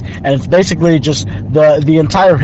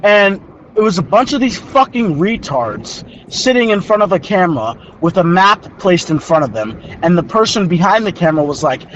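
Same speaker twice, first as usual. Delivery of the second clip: ordinary talk, nothing marked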